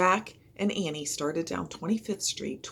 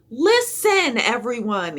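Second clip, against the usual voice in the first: higher, louder call; yelled; 6 semitones higher, 8 dB louder, slower than usual. A narrator's reading. Jack calling to the crowd on the beach, a loud high yell. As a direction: grab the crowd's attention. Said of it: "he yelled".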